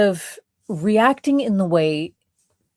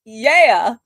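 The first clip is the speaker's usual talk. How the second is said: high, sing-song mock come-on; mock flirt; 9 semitones higher, 7 dB louder than usual. Coming on to the captor, a high giddy mock flirt. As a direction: play a giddy, over-friendly flirt. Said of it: "you can just be like".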